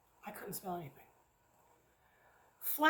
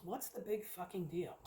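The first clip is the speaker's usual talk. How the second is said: muttered aside; 4 semitones lower, 10 dB quieter than usual. Real, not acted. Grumbling aside about waffles scent.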